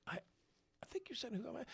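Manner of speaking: soft, halting, unsure reply; hesitant. Retold or imitated. Newcomer guesses what the Italian asked, soft and tentative. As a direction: feel your way through a guess, very quietly.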